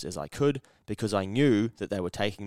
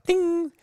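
high, louder notification ding; sung-out high ding sound; imitated ding; 7 dB louder than usual. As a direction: chirp it like a phone alert.

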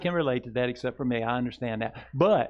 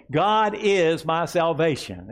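slow, higher and louder words to echo; slow proclaimed declaration; 5 semitones higher, 5 dB louder, slower than usual. Shown as a demonstration. Pastor leading the congregation in a slow proclamation. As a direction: ring it out slowly. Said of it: "Say it again".

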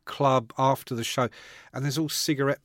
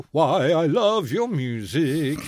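[name] voice; slower than usual. Retold or imitated. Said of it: "in his voice".

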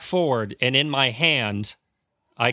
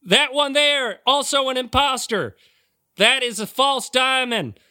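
fake deacon preaching; 12 semitones higher than usual. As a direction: proclaim it to the faithful.

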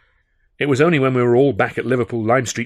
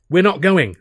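1 firm loud answer; 8 semitones higher, 7 dB louder than usual. A narrator's reading. Alf breaks bad news.

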